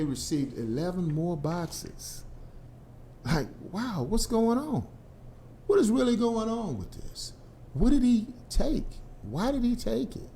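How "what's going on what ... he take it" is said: incredulous. Real, not acted. Incredulous questions about Trump.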